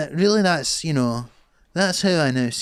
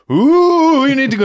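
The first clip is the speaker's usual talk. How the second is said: spooky actor; 12 semitones higher, 9 dB louder than usual. Retold or imitated. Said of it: "actors would come in and go".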